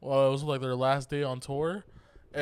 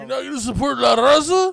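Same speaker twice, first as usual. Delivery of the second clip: much higher, louder indignant yell; yelling vendor; 9 semitones higher, 11 dB louder than usual. Retold or imitated. Imitating the vendor yelling at the concert, loud indignant. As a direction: shout it like he's offended you won't pay. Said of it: "I got yelled at at [name]".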